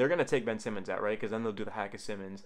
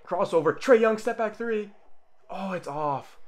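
excited; 9 semitones higher, 7 dB louder, slower than usual. Real, not acted. Live reaction to a step-back.

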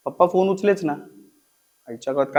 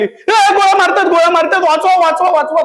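high, loud mock panic; panicked scream; 12 semitones higher, 4 dB louder than usual. Sister screaming at night about gunfire, panicked. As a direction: yell for help as if shot at. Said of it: "माझी बहीण ओरडायला लागली".

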